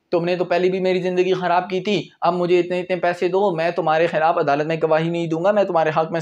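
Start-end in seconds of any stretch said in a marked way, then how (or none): none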